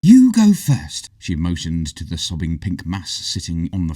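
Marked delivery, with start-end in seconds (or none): 0.0-1.1 put-on high, slow girl's voice; young heroine speaking slowly; Hazel's voice. A narrator's reading.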